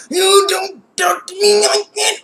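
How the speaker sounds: high, slow, shouted talk-back; mock shout. Tomoko talking back to her mother in a high mock shout. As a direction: yell it back big and defiant. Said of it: "freaking Tomoko's like".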